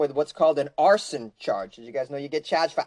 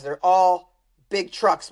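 ordinary delivery

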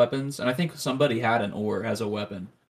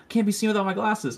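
higher, fussy Velma whine; retelling the cartoon, a higher mock Velma whine; 8 semitones higher than usual. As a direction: plead for the glasses in a higher voice. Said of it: "she's like".

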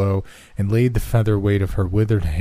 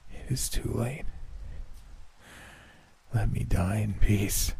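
faint dying voice; 9 dB quieter than usual. A narrator's reading. Dying man pleads.

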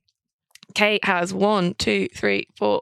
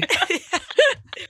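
high laugh; 8 semitones higher than usual. Real, not acted. Host laughing at players.

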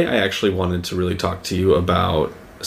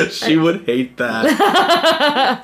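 laughing; 12 semitones higher, much slower than usual. Real, not acted. Laughing at her own joke.

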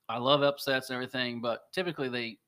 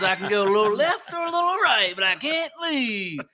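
gruff guy voice; 9 semitones higher, 8 dB louder than usual. Retold or imitated. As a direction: voice him high and dragged out. Retold guy continues.

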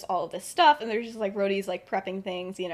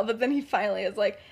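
ordinary delivery

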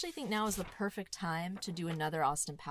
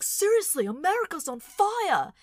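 much higher, shouted outrage; high, loud frenetic host shout; John Oliver impression; 11 semitones higher, 10 dB louder than usual. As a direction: explode into a frantic rant. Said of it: "frenetic energy... he's like".

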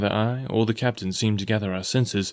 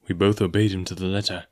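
plain reading voice

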